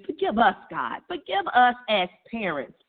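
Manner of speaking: normal talking voice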